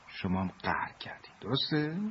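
soft knowing whisper; whispering her guess to the sulking kids; teasing whisper. Acted. Velota teasing, guessing the secret.

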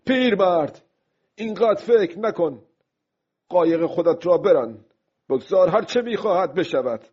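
aloud to himself. Acted. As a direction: order yourself to stop brooding, voice lifted. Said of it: "با صدای بلند به خودش گفت". (با صدای بلند و زیر، خطاب به خود)

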